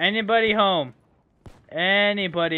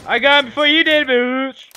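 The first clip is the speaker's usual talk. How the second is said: fast, loud, higher jeer; real mocking boast; gloating taunt; 5 semitones higher, 6 dB louder than usual. Real, not acted. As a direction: rub it in. Taunting a rival player.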